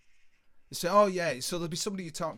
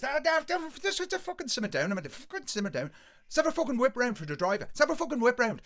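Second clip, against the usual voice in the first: high, hurried, raised voice; pushy ringleader; 9 semitones higher, 5 dB louder, faster than usual. Retold or imitated. Ringleader calming driver and rallying lads, pushy. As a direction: talk over him fast and high.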